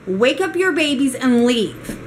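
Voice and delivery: high, emphatic mocking imitation of a rude clerk; rude, condescending. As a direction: scold a guest with rude finality. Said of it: "And she was very rude about it".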